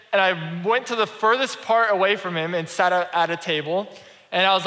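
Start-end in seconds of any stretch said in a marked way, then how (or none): none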